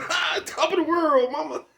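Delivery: laughing movie quote. Retold or imitated. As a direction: shriek it out through a manic laugh.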